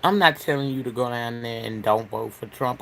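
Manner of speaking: normal talking voice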